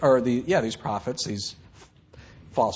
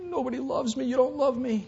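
high, teary self-pitying whimper; high, soft tearful whimper; whiny crying; 9 semitones higher, 3 dB quieter than usual. Retold or imitated. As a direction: sob it out like a sulking child. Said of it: "And God says".

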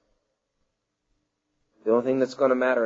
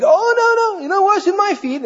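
high-pitched, loud mock protest; Peter protesting; 3 dB louder than usual. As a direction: act out a hammed-up, squealed refusal. Preacher acts out Peter refusing.

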